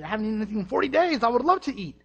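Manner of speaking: almost give in, hungry and eager; mock-tempted voice; hammed-up craving. Jesus tempted, craving food.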